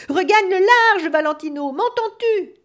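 frantic father bellowing over the storm; high, loud desperate shout. Acted.

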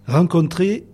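slow, measured recitation with long pauses; poem reading. Acted. Guest reciting a Delteil-style truffle recipe on air, poetic reading. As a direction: read each instruction like a line of poetry.

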